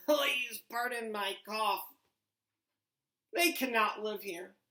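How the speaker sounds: a hint of a fussy Lorax. A narrator's reading. Reads the Lorax's lines.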